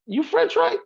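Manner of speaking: acting out his own amazed surprise; surprised question. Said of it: "I was like".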